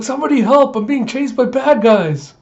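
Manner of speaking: put-on high, loud childish cry for help; shrill high shout for help; calling for help. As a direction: yell it like a kid in a panic. Anya crying for help.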